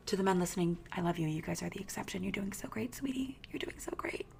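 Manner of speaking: hushed, patronizing coo to the men; mock sweet whisper. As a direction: whisper it like soothing a child. Mock-sweet aside to male listeners.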